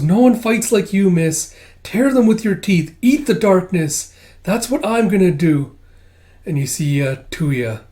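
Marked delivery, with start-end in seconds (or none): 0.0-5.8 high, loud, determined character voice; rally her fiercely, voice pitched up; fervent rallying. Acted. Kippa urges her friend to fight, fervent.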